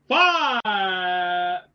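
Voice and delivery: loud, high announcer shout. Acted. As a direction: boom out the number. Dramatic number reveal.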